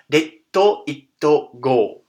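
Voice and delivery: detto itto go said slowly, syllable by syllable, Japanese style; spelled-out detto itto go. Shown as a demonstration. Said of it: "more like".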